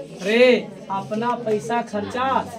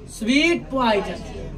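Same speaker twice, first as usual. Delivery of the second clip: Sweet poison said very slowly with a laugh; drawn-out punchline; 3 dB quieter, much slower than usual. Shown as a demonstration. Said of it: "तो ओकरा कोची कहेंगे?". (हँसते हुए धीरे-धीरे sweet poison)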